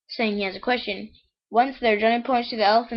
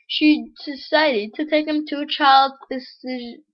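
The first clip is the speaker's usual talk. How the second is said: higher-pitched, measured read-aloud voice; joke storytelling; 6 semitones higher, 4 dB louder than usual. Acted. Reads the next joke aloud.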